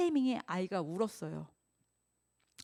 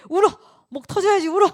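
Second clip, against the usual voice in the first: high, loud joyful shout; 신나는 외침; 9 semitones higher, 13 dB louder, faster than usual. Acted. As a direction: cheer the baby on at full voice. 엄마가 신나서 아이에게 외침. (아이에게 크게 울라고 신나게 외치는 엄마)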